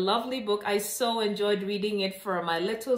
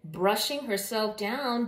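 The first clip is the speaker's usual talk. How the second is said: ordinary delivery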